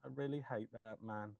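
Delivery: low, soft deadpan delivery of a film quote; deadpan quoted line. Retold or imitated. Friend quoting a Jurassic Park line, soft and deadpan. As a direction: murmur it flatly, like reciting a favourite line.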